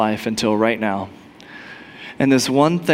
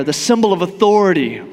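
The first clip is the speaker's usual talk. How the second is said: ordinary delivery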